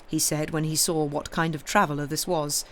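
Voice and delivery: ordinary delivery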